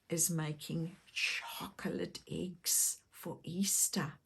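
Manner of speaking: soft whisper sharing a secret. Pretend. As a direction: whisper it to the child. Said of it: "But it's a secret".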